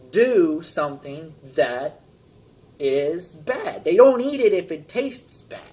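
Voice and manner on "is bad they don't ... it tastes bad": normal talking voice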